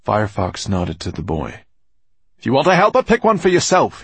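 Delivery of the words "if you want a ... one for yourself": loud, high angry growl. A narrator's reading. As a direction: growl it back. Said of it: "he growled".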